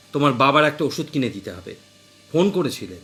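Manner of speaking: ordinary delivery, nothing marked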